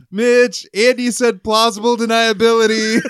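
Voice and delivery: high, loud mock tattling shout; retold yell. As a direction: imitate a coworker yelling out an alarm. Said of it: "he yelled at our project manager".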